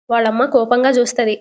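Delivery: ordinary delivery, nothing marked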